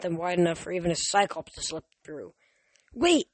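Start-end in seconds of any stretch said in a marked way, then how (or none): 2.9-3.3 high, urgent call; raised, sudden cry. A narrator's reading. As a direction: call after someone to halt. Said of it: "Grover called".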